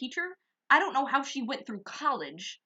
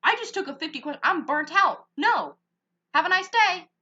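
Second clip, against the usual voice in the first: louder, higher sassy brush-off; defiant refusal; 6 semitones higher, 7 dB louder than usual. Retold or imitated. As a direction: shut her down loudly and dismiss her.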